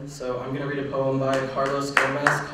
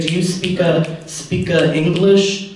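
higher, slowed mock broken English; 5 semitones higher, 12 dB louder, slower than usual. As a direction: taunt in exaggerated broken English. Acts bigot mocking a non-native speaker.

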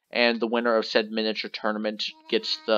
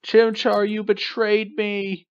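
mock betrayal cry; 8 semitones higher, 4 dB louder, much slower than usual. Mock betrayal at his Pokemon.

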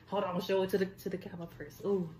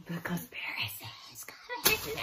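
breathy hushed whisper, pitched up; spooky whisper; 3 dB quieter than usual. Pretend. Teasing her friend Ocean with a spooky whisper. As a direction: whisper it like a secret omen.

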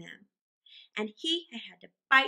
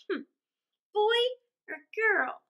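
lightly voiced small boy, slow and high; drawn-out, tentative high question; not too hopeful; 10 semitones higher, slower than usual. A narrator's reading. Small boy asks his mother, slow, high and tentative. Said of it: "not too much hopefully".

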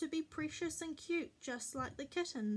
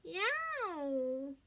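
put-on drawn-out toddler cry, swooping high; mild baby shriek; 3 semitones higher, 4 dB louder than usual. A narrator's reading. Baby Louie sees the kittens, mild shriek. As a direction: shriek like an excited baby, lightly. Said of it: "Baby Louie shrieked".

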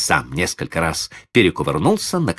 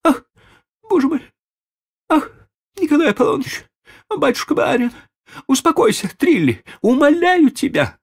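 frantic lady; 3 dB louder than usual. A narrator's reading. Frantic lady soothing child.

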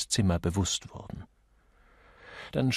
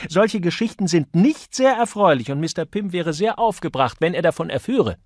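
high, loud, scolding woman; indignant Misses Johnson; 8 semitones higher, 7 dB louder than usual. A narrator's reading. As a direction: tell him off from on high.

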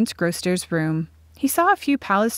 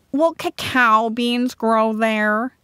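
higher, slow, plaintive question; lightly voiced Groster; 4 semitones higher, 3 dB louder, slower than usual. A narrator's reading. Groster asks Talder about cacao beans, plaintive. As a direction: ask it slowly with a hint of whine.

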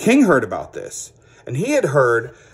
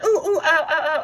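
very high puppet yelping ow; princess puppet yelping; 14 semitones higher than usual. Acted. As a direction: squeal in pain like a princess puppet. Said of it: "she's like".

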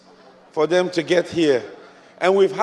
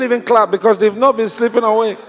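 loud, raised-pitch sermon delivery; full-force preaching; 7 semitones higher, 7 dB louder than usual. Acted. Preacher challenging church members' excuses for not praying, full-force preaching. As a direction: drive it home to a congregation at full voice.

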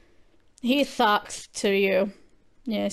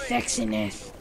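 whispered; 7 dB quieter, slower than usual. Real, not acted. Answering viewer question.

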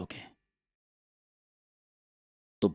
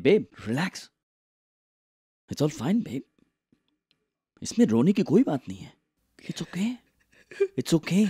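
soothing; 7 semitones higher than usual. Acted. Consoling crying wife on phone.

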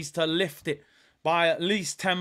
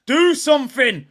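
loud, higher repeat of the plea; demanding shout; 6 semitones higher, 8 dB louder than usual. Real, not acted. Fan shouting at Zinchenko to do something. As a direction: yell it at him now.

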